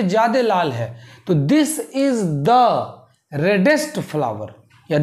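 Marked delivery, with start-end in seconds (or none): 1.4-4.5 very slow, word by word; dictating pace, each word apart; slow dictation. Shown as a demonstration. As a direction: spell it out slowly for students. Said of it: "teacher dictating slowly".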